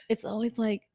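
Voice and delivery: ordinary talk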